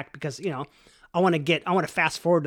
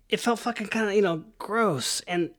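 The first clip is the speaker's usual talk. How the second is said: normal talking voice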